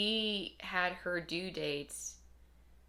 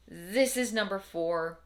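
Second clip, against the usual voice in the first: usual speaking voice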